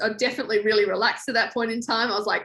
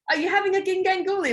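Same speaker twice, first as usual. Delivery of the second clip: high, quick and grinning; the instructor grinning as he teases, a high quick call; smiling Ant voice; 9 semitones higher, faster than usual. Retold or imitated. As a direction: say it with a smile. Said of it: "Like, smiling".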